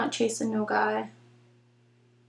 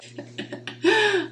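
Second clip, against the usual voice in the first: high giggle; 10 semitones higher, 4 dB quieter than usual. Real, not acted. Laughing at own joke.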